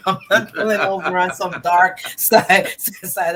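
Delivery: loud, laughing through the words; laughing refusal. Real, not acted. Guest refusing to stop on a dark highway, loud and laughing. Joking refusal to pull over at night.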